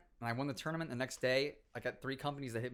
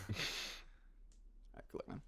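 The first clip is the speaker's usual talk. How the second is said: dismissive mumble; 15 dB quieter, much faster than usual. Retold or imitated. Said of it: "He's like".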